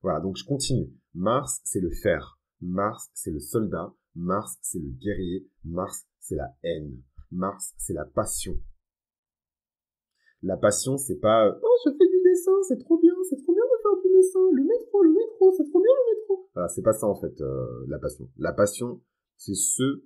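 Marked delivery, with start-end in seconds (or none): 1.1-8.6 very slow, weighty litany; slow declaiming. Acted. Solemn litany of Mars meanings. 11.6-16.5 squeaky, very high mock enthusiast; silly high voice. Parodying a fake passion.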